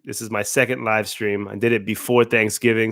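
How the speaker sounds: ordinary talk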